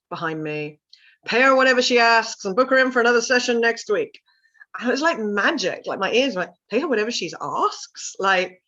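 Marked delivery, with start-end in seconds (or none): 1.3-4.2 loud, higher call from behind. Retold or imitated. Husband calling after the coach, loudly. 6.7-8.2 a rising, amazed "Pay her whatever she asks?"; can't believe what she heard; incredulous echo. Retold or imitated. Coach echoing the client's words in disbelief.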